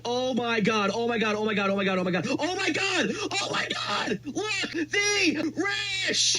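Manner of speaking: high-pitched ecstatic shrieks; screaming with joy at the win; shrieking jackpot winner. Real, not acted. Shrieking over a lucky spin.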